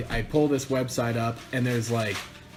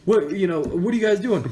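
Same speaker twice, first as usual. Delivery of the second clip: eager question; 7 semitones higher, 5 dB louder than usual. Retold or imitated. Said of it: "I'm like".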